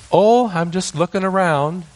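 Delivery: put-on high, caught-out little boy; sheepish child. Caught grandson greeting grandpa, high child voice.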